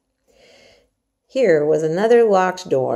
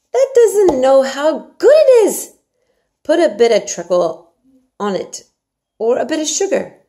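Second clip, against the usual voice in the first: Yorkshire maid Martha; 8 semitones higher, 9 dB louder than usual. Acted. Martha urges Mary to eat her porridge, hearty and coaxing. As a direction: coax the child like a bossy country servant.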